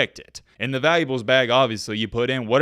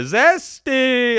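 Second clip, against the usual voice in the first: drawn out slowly and pitched higher, lightly imitating; mock Travis Hunter zesty; 10 semitones higher, 4 dB louder than usual. Host lightly imitating the player's catchphrase, slow and higher. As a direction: stretch it like his signature catchphrase. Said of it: "That's his signature thing in the video".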